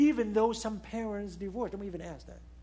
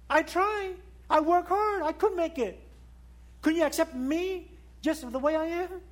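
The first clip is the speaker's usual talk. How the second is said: put-on a child's hurt plea, raised higher and louder; pleading child; 7 semitones higher, 5 dB louder than usual. Child begging parents to accept him, pleading. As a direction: plead like a frustrated child who tried hard.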